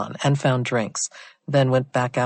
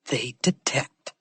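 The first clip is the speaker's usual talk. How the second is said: smug; 6 dB quieter, slower than usual. A narrator's reading. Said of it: "he replied smugly".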